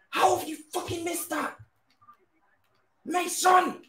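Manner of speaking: very high, strained outcry; real high-pitched frustrated yell; exasperated rage. Real, not acted. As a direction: wail at the player in disbelief. Raging at player's miss.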